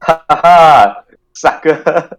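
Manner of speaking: hammed-up gloating shout; mocking shout. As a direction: gloat loudly at the victim. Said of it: "She just sort of shouts and goes".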